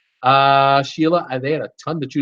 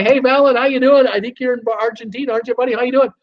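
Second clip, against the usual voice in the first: host greeting a viewer in Argentina, much higher; excited greeting; 7 semitones higher than usual. Real, not acted. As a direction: call out happily to a buddy.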